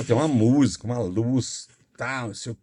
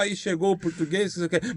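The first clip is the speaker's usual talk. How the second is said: ordinary talk, nothing marked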